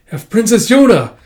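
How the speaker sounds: high, loud cry for the princess; acted alarmed shout; urgent call. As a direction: burst in calling out. Messenger bursts in calling.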